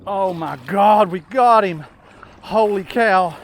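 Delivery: loud joyful yell as he lands it; excited shout. Real, not acted. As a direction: shout with pure thrill. Landing the big fish.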